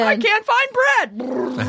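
slow, drawn-out hungry lament; hammed-up starving wail; mock Depression-era wail. Joking about economic depression, mock desperate wail.

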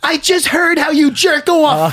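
high, loud, drawn-out mock accusation; imitated wife. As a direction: stretch it out as a scandalized wife, hammed up. Voices wife's text message for laughs.

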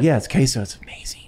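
breathy secret whisper. Real, not acted. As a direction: whisper it like a confession. Whispered aside about the queso.

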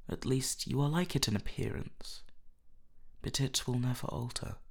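a touch of wistfulness; sighing. A narrator's reading. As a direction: say it like a sigh. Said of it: "sighed Hallward".

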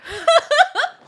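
loud high-pitched laugh of disbelief; sudden shrieking laugh; burst of high laughter. Real, not acted. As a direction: crack up in disbelief. Shocked at partner's excuse.

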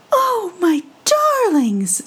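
a mother bird crooning to her chicks; a fond mother robin, high and slow. Acted. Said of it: "said Missus Robin".

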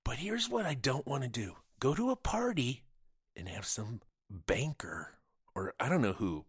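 normal talking voice

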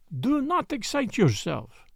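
slow, placid and unbothered; calm the butler down, placidly. A narrator's reading. Detective soothing the butler, slow placid calm. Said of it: "said Poirot placidly".

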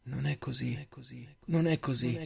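soft echoing denial, over and over; echoing inner voice. A narrator's reading. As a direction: repeat it like a haunting thought. Echoing voice in heroine's head. (voce nella testa, sommessa, con eco)

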